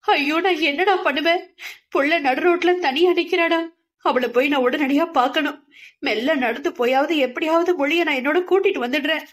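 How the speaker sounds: high, frantic wailing of a mother; a frightened mother blurting out fast; panicked mother. A narrator's reading. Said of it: "படபடத்து". (பதறிய அம்மா குரல்)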